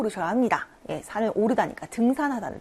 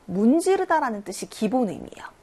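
ordinary talk, nothing marked

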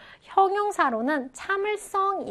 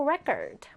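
"record" said REC-ord, falling after the first syllable; stress on first syllable; 2 semitones higher, 4 dB quieter than usual. Shown as a demonstration. Teacher repeats the noun form, first syllable of "record" stressed. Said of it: "그럼 발음을 어떻게 해요?".

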